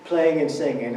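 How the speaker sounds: ordinary talk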